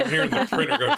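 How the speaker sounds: high, laughing through the words; giggling at the printer noise; real laughter breaking up the line. Real, not acted.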